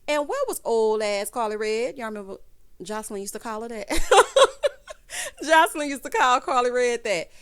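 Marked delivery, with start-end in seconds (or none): 3.9-5.4 high, loud real cackle; loud burst of laughter. Real, not acted. Vlogger laughing at the nickname for Carly Red, loud cackle. 5.4-7.3 louder, said through a laugh. Real, not acted. Vlogger repeating the nickname through laughter.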